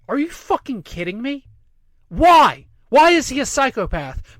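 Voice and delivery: high, exasperated, with a loud burst on "Why"; incredulous outrage. Real, not acted. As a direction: fire back in indignant disbelief. Incredulous rant at a columnist's quote.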